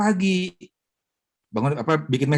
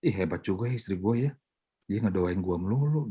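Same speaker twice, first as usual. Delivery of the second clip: much softer, faster impressed musing; quietly amazed at his wife; impressed husband; 4 semitones lower, 7 dB quieter than usual. Retold or imitated. Husband overhearing his wife's prayers, softly impressed. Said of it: "denger deh".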